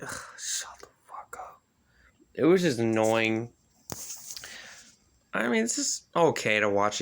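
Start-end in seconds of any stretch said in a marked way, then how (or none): 0.0-1.6 whispered, fed up; annoyed muttered whisper at the screen. Retold or imitated. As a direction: hiss it under your breath in annoyance. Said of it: "I'm just like".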